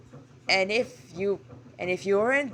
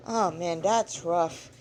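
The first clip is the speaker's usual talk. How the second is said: ordinary delivery, nothing marked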